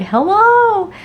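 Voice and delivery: high, drawn-out sing-out hello; exaggerated, swooping happy hello; playful greeting. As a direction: act out greeting a favorite relative. Acting their greeting at family gatherings.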